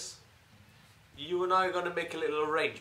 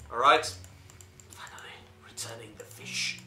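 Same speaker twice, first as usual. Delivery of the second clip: whispering; 3 semitones lower, 4 dB louder than usual. Real, not acted. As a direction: whisper it to yourself. Whispered aside while playing.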